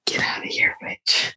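harsh unvoiced whisper. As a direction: hiss it out in a whisper. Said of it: "That's exactly what I hear".

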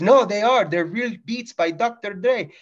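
high, indignant vendor voice; imitating the insistent clerk. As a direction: protest like an offended shopkeeper.

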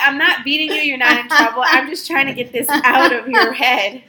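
loud, high, over-the-top pleading; retold frantic reassurance. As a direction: shout it over the child's imagined panic.

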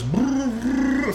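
high, drawn-out blubbered brrr; imitated crying. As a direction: mimic bursting into tears with a long high brrr. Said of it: "I just cried".

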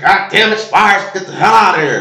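high, loud yelling coach impression; mock coach yelling at a player; imitating the coach. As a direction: bark it like an angry old coach. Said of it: "that's how he used to talk".

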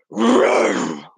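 very low, growled roar; lion roar. A narrator's reading. Lions in the fog roaring at Ruva. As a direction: rumble like a big lion. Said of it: "went the lions".

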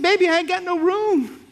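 put-on old aunt reminiscing. Aunt telling her nephew about his dad, high-voiced. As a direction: gush the memory like a doting great-aunt. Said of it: "I said".

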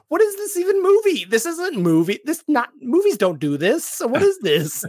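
high, loud confused outburst; baffled disbelief. Retold or imitated. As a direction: voice shooting up in disbelief. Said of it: "I'm like".

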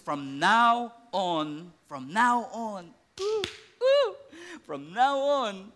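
very slow drawn-out declaration; Jesus solemnly declaring the new era; slow solemn repetition. Acted. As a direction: stretch each word out.

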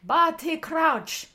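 drawn-out, high and loud character imitation; Barty Crouch. Two friends quoting Harry Potter, hammed-up character imitation. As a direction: stretch the name out, hammed-up impersonation. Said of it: "That was a horrible impersonation".

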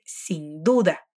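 slow and soft, each syllable clear; slow model phrase. Shown as a demonstration. Teacher modelling a phrase slowly and softly. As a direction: say it slowly so the learner can copy.

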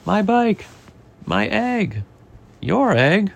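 high overlapping-style protests from storybook characters; lightly acted cries of alarm; crowd outcry. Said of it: "They say".

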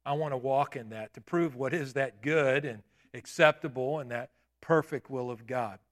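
ordinary delivery

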